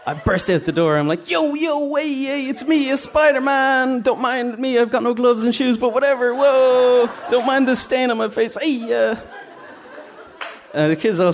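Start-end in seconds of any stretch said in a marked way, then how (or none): 1.3-9.2 high-pitched party-entertainer Spider-Man voice; hammed-up Spider-Man. Spider-Man greeting the children in the alley, hammed-up and high. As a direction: burst in as an overeager, makeshift Spider-Man.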